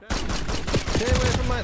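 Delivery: loud, slow, sharp yell; shouting. Real, not acted. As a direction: yell it out at the enemy. Shouting at enemy mid-fight.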